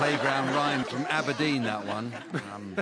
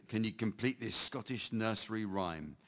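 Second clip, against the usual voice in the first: ordinary talk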